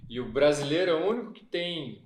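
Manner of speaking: usual speaking voice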